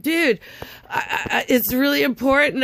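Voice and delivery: high, stammering retold plea; urgent plea. Caller pleading with the newsroom man, urgent.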